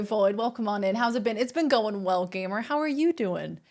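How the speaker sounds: normal talking voice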